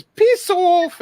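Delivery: high, loud mock British yelp; British accent. Ranger recalling an all-day British act, loud high British accent. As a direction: shout it high as a cartoonish British guy. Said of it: "talking like this, this British guy".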